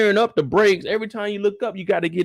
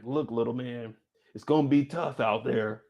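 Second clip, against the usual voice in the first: put-on lower, softer fatherly pep talk to a toddler; mock pep talk; 8 semitones lower, 6 dB quieter than usual. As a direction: play it like a dad giving a serious speech, low and soft. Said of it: "what kind of motivational speeches".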